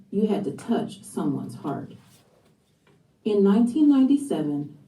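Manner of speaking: usual speaking voice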